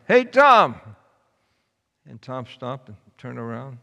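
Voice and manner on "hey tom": high, loud shout across the room; yell. A narrator's reading. Little Tim calls after Tom down the aisle, loud high yell. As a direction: call out to stop him leaving. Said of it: "yelled out".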